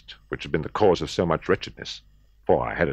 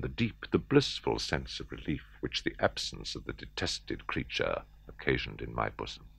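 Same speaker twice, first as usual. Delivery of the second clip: hushed relief; 5 semitones lower, 8 dB quieter than usual. A narrator's reading. Narrator savours relief, hushed.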